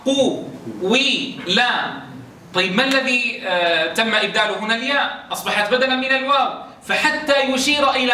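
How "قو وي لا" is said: قو-وي-لا with the u sound drawn slowly into i, each syllable stretched; slow drawn-out ishmam. Shown as a demonstration. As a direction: glide slowly from u to i in قو-وي-لا. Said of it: "ما أصل القاف؟". (نطق قو-وي-لا ببطء مع إشمام الضم)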